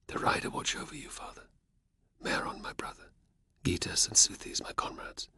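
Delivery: hushed, voiceless prayer for lost kin; whispered prayer. Acted. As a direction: breathe the names almost silently. Prayer for the dead.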